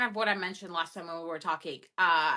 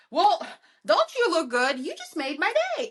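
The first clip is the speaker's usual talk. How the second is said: high, louder sing-song flattery; flirty compliment; 7 semitones higher, 3 dB louder than usual. Pretend. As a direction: gush the compliment like a charmer. Voicing an imagined street compliment.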